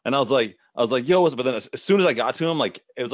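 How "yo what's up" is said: hyped casual greeting. Retold or imitated. Rehearsed cool greeting to a comedian.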